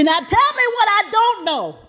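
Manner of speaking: high, insistent mock demand; mama pressing the principal hard; mother's demand.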